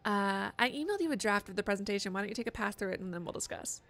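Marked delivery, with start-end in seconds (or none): none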